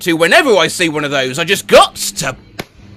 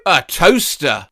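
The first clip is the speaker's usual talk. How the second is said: ordinary delivery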